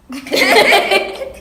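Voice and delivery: loud giggle. Acted. Girls gossip about boy.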